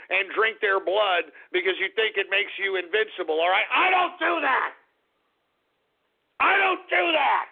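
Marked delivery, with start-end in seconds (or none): 3.7-4.7 high, emphatic protest; indignant outburst. Real, not acted. Host angrily denies after calmer rant. 6.4-7.5 high, outraged repeat falling at the end; indignant outburst. Real, not acted. Host repeats the outraged denial.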